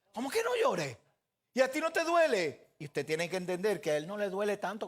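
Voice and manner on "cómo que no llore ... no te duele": high, sharp indignant protest; indignant wife. Retold or imitated. Crying wife snaps back at her dismissive husband, higher and louder. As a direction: fire it back at him, offended and rising.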